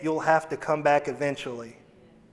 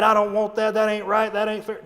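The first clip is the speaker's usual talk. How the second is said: mock angry child; 5 semitones higher, 3 dB louder than usual. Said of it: "or get mad".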